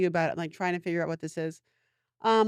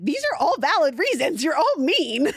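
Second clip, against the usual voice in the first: mock exasperation; 8 semitones higher, 6 dB louder, slower than usual. Mock reply to blocked commenters.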